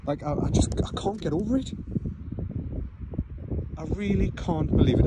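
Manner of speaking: high, soft, wide-eyed wonder; barely believing what he sees; awed disbelief. Real, not acted. Stunned by bright aurora.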